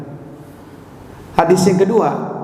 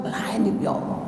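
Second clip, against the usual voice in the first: tearful whisper; 7 semitones higher, 9 dB quieter, slower than usual. Retold or imitated. Utsman's words trailing into a sob.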